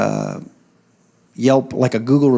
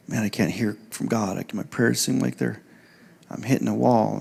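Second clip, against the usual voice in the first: discouraged complaint; 2 semitones lower, 9 dB quieter than usual. Retold or imitated. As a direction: imitate someone quietly admitting defeat. Voicing discouraged young men's complaint.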